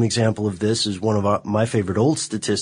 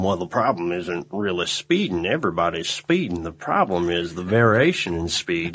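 a lightly lowered silly voice; playful mock-serious put-on voice; 4 semitones lower than usual. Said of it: "silly voice aside".

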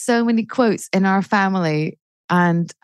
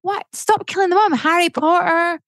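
much higher, impassioned rant; 9 semitones higher than usual. Real, not acted. Ranting to the host about Disney mums, impassioned. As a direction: let the indignation run away with you. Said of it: "Sorry, I'm ranting".

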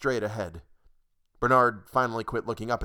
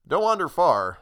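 mild warning, louder and higher; cautioning; 7 semitones higher, 6 dB louder than usual. Acted. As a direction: call out a friendly caution. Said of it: "a voice behind him cautioned".

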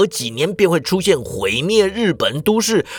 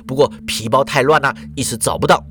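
ordinary delivery, nothing marked

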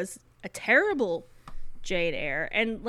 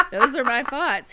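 a louder, higher answer through a real laugh; 4 semitones higher, 9 dB louder than usual. Real, not acted. Answering her co-host's question about St. John, laughing loudly. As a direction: laugh it out, half joking.

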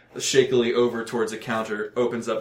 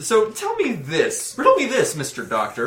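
theatrical challenge; 9 semitones higher than usual. Pretend. Game master theatrically challenging the doctor player.

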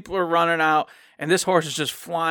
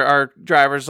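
normal talking voice

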